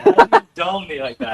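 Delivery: high, loud disbelieving shout; outraged yell. Real, not acted. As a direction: yell it in shock. Just shot in the head.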